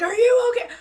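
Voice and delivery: high, loud worried cry; worried follower. Retold or imitated. Followers checking on her, high alarmed worry. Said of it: "They're like".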